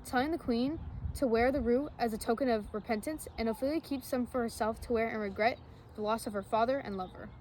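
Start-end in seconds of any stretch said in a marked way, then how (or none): none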